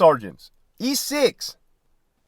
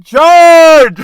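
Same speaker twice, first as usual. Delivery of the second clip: very loud, high mock yell; yelled charge; 11 semitones higher, 14 dB louder than usual. Managers yelling from their office, a very loud mock battle cry. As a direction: belt it like a boss rallying troops. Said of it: "they're yelling".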